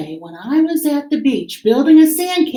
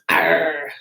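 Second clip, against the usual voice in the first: low growly pirate arr; 6 semitones lower than usual. Shown as a demonstration. Teacher showing the class a pirate arr. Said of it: "like a pirate".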